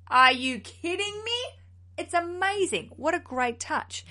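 high, loud burst of delight; thrilled, high-pitched exclamation; gushing amazement. Real, not acted. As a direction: gush as if you can't believe it. Gushing over the perfume idea.

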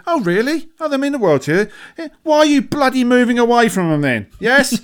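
high, mocking "Oh, really?" with a laugh breaking through; mock disbelief at the nan, laughing; sarcastic retort. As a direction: scoff at her, pitch up and sneer.

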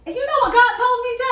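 high and loud, rushing the question; excited build-up to the punchline. Real, not acted. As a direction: burst out eager to tell it. Excited story climax.

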